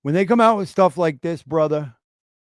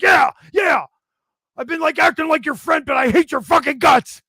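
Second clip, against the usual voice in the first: high, loud venting in an imitated voice; mock hateful confession; 10 semitones higher, 5 dB louder than usual. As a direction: mimic someone finally yelling out their hatred. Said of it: "And they're like".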